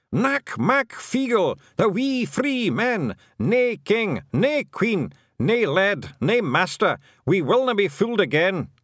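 loud, high clan chant; defiant war cry. A narrator's reading. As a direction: chant it proudly, each phrase punched high.

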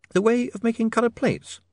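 put-on high, questioning young woman; young woman's voice. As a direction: lift it high into a curious lady's voice. Sacharissa's voice in narrated dialogue.

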